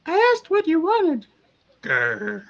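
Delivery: very high impatient little voice with a growl; high-pitched voice. Acted. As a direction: squeak it out, then growl. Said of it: "the voice says".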